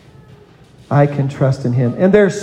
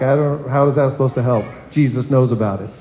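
lower, deflated, unimpressed retort; half-hearted sarcastic echo of the comfort; mock dismissive; 4 semitones lower, faster than usual. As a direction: toss it off as if it means nothing. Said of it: "I was like".

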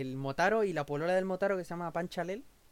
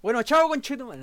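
loud fake walk-out; mock angry goodbye; 7 semitones higher, 10 dB louder than usual. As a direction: shout it as a joke. Fake storming off.

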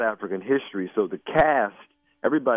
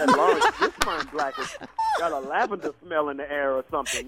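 mock fancy voice; 8 semitones higher than usual. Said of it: "And I was like".

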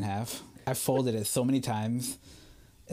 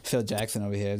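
ordinary delivery

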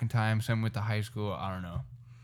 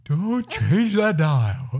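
loud, high, drawn-out announcer shout; radio announcer impression; 6 semitones higher, 7 dB louder, much slower than usual. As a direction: belt it slowly like a radio jock. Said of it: "That wasn't that good".

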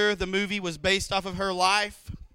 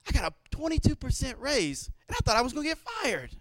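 ordinary delivery

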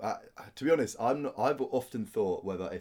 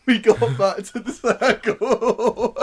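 laughing; 13 semitones higher, 12 dB louder, much slower than usual. Real, not acted. Laughing while telling story.

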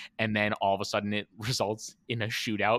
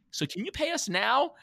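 much higher, light mock question; hint of an innocent voice, pitched up; imitating Norm; 4 dB louder than usual. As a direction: ask for payment as if nothing happened. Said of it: "Norm says something like".

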